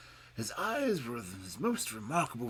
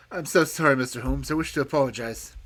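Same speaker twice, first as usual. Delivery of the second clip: panting apology; 10 dB louder than usual. A narrator's reading. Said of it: "He came panting up to us".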